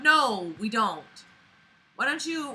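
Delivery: usual speaking voice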